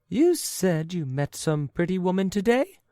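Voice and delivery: high, loud incredulous wife; the wife pounces on his words; sharp wife. Acted. Wife cutting in on her old husband, high and sharp.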